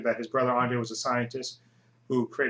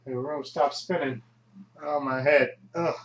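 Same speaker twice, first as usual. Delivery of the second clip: groggy, groaning hangover voice; nursing a pounding headache; hungover; 3 dB louder than usual. Acted. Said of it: "I'm a little dehydrated".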